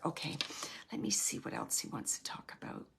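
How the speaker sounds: murmured under the breath to herself; soft whispered self-talk; whispered aside. Real, not acted. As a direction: drop to a hush, as if checking in quietly. Whispered aside while listening.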